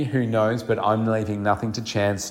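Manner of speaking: ordinary talk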